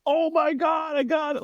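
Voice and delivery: high squealing delight; giddy excitement. Retold or imitated. Comic getting the cable box working, high giddy squeal. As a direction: squeal like a kid who won. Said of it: "and I was like".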